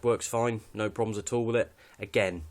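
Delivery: ordinary talk, nothing marked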